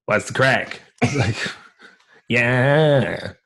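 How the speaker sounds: slow Irish character, vowels dragged out; drawn-out catchphrase and boasts in character; Viper. Acted. Comedian does his character on request, slow Viper voice. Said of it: "can you do Viper?".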